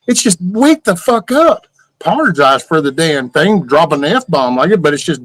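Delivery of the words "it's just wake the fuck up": high, slow exasperated plea; exasperated outburst. Real, not acted. Ranting at rude people online, high exasperated outburst. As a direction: pitch it way up like you've had enough.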